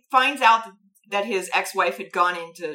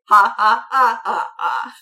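mock android laugh; 2 semitones higher, 9 dB louder than usual. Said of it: "And the android is like".

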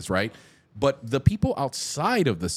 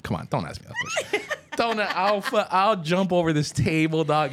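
high, fast, laughing protest; laughing excitement; 8 semitones higher than usual. Real, not acted. Fan laughing at his friend's question about sports. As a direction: pretend outrage at a silly question.

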